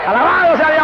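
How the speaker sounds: high, loud, exultant shout; preacher's ringing exclamation; praise shout. Real, not acted. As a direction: cry out praise to the whole congregation.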